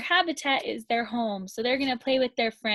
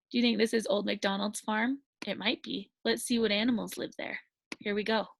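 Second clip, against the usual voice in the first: put-on reading to a toddler; 3 semitones lower, 5 dB quieter, much faster than usual. Storybook voice to toddlers.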